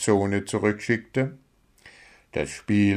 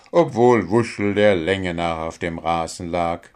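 ordinary delivery, nothing marked

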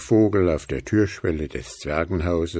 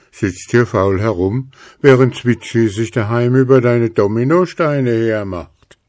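louder, chiding snowman; snowman scolding; 3 semitones higher, 7 dB louder than usual. A narrator's reading. Snowman scolds Willi about the dominoes, chiding. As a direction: scold him for lazing about.

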